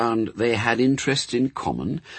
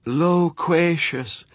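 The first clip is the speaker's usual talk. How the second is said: slow, hollow, distant-sounding single word; 8 semitones higher, much slower than usual. Acted. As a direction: drag it out like a voice from far away. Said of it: "in a hollow voice".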